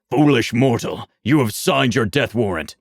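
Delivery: a lightly voiced menacing demon; hint of a sinister demon voice; dark lord. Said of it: "The demon says".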